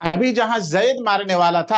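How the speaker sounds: normal talking voice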